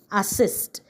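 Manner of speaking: ordinary talk, nothing marked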